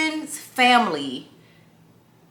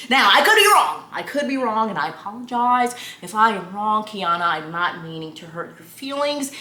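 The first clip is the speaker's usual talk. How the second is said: put-on careful, over-proper apologetic boyfriend; imitating Justin. Imitating boyfriend apologizing to Kiana in case he's wrong, mock-proper. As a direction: hedge and apologize in mock-delicate, proper speech. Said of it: "That's how they speak".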